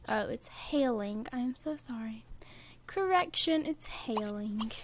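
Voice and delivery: hushed whisper. Real, not acted. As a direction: whisper under your breath. Whispering about hail outside.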